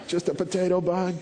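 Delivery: put-on high little-girl marvel over a bug; imitated thrilled child. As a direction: mimic a small daughter losing her mind. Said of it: "Absolutely loses her mind".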